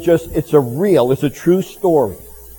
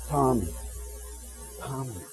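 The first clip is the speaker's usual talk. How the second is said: soft, much quieter call of a name, drawn away; loving call; 14 dB quieter than usual. Retold or imitated. A loving voice calling Tommy from beyond, soft. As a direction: call him gently from far off, with love. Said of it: "calling my name with love".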